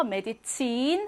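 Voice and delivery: "메디친" with "친" held long; German "메디친", long stressed final syllable; long vowel. Shown as a demonstration. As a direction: stretch the last vowel of "메디친". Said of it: "길게 발음하고요".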